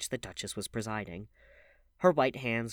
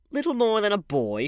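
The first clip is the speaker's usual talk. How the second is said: high, loud mocking sneer; 12 semitones higher, 10 dB louder than usual. A narrator's reading. Bitter woman mocking James Vane's words, loud sneer. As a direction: throw his words back with contempt. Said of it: "she sneered".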